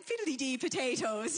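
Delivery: hammed-up Irish mum; thick Irish accent. Mum answering her child in a mock Irish accent. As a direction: parody a thick Irish brogue. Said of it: "do you have a thick Irish accent".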